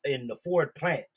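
ordinary talk, nothing marked

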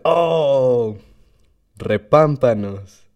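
exclamación sorprendida y lenta; surprised. Retold or imitated. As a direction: asombro exagerado, alargado. Cara de sorpresa del compa. (sorpresa fingida y lenta)

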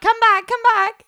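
high, loud, playful call. Real, not acted. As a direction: call him back playfully. Calling after producer.

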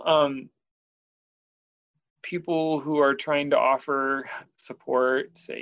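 ordinary talk, nothing marked

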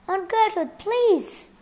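high, loud urgent plea; exclaiming plea. A narrator's reading. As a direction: call out to stop the aunt. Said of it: "Frank exclaimed".

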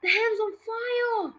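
high, drawn-out alarm; mild yell, stretched out. A narrator's reading. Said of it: "Grandma yelled".

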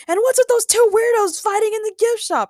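very high, loud question; outraged yell. A narrator's reading. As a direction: yell it in high-pitched disbelief. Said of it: "Ray yelled".

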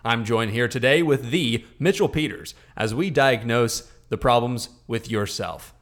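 loud halftime-show host, proclaiming; put-on announcer accent. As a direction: announce it big like a sports show opener. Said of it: "You did an accent".

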